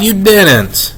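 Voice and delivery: high-pitched motherly disbelief; dismayed cry. A narrator's reading. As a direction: exclaim it, mildly appalled. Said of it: "my mother cried".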